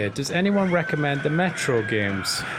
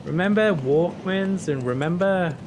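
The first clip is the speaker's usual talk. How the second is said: slow, higher, drawn-out reminiscing; mock nostalgia; 4 semitones higher, slower than usual. Mocking shows that sell old references, mock nostalgia. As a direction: stretch each reference in a put-on voice.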